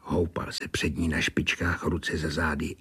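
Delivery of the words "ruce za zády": ordinary delivery, nothing marked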